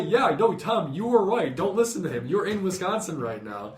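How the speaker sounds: high, loud retold yell to the stage; crowd shouting. Fans at a concert yelling up to the singer, high and loud. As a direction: call out over the crowd to correct him. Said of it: "And we're all trying to say".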